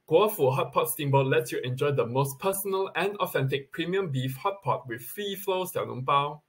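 ordinary talk

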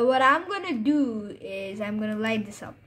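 usual speaking voice

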